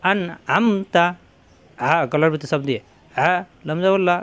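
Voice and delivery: usual speaking voice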